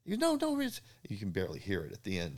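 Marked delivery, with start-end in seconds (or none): 0.2-0.8 mailman voiced much higher and louder; imitating an excited mailman with news. Mailman insisting in story.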